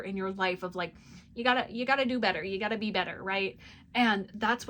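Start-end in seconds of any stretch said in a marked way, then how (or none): 1.3-3.2 quick, loud pushy-mother voice. Acted. Voice from your life pushing you to improve, mothering voice. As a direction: urge it like a nagging mother. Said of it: "why I use that voice".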